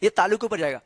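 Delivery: ordinary talk, nothing marked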